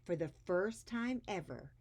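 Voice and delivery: put-on lively read-aloud voice for children; expressive storybook reading; story narration. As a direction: read it like a picture book to a class. Reads picture book aloud to kids.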